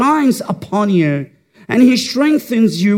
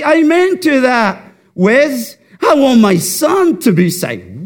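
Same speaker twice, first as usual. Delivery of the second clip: eager father; 6 semitones higher, 4 dB louder than usual. Pretend.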